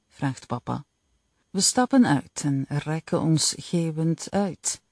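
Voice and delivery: ordinary delivery, nothing marked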